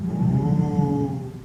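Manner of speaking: drawn-out ghostly ooh moan; spooky moan. Retold or imitated. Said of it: "we heard".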